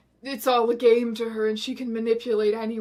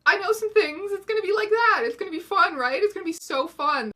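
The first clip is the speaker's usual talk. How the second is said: high, loud, eager self-assurance; overconfident excitement; 7 semitones higher, 3 dB louder than usual. Retold or imitated. Her past self expecting fun pulp, excited and high. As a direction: talk yourself up before the book. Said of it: "I, I was like".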